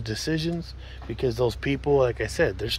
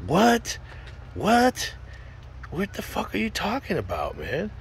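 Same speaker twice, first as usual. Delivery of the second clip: stunned disbelief; 7 semitones higher, 4 dB quieter than usual. Retold or imitated. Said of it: "I was like".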